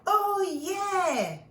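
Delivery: high, loud whoop; gleeful oh yeah; excited shout. A narrator's reading. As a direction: cheer it for the kids. Exclaimed answer to story question.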